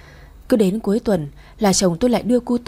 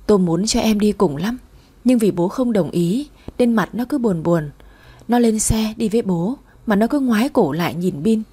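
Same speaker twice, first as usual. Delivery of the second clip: ordinary delivery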